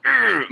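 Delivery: high, loud forced grunt of fake passion; mock overacting grunt; strained overacted grunt. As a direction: push out a hammy, red-faced ugh. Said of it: "And behind your".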